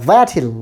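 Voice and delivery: THAT'LL stressed on the first syllable, then falling; stress at start. Shown as a demonstration. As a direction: punch 'that' in 'that'll', reduce the ending. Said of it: "stress দিবেন শুরুতে". ('that'll'-এর শুরুতে জোর)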